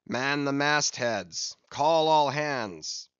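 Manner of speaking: long-drawn ship's order, loud on the last words; calling out orders. A narrator's reading. Captain rousing all hands to the mastheads, calling out. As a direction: stretch out each word of the command.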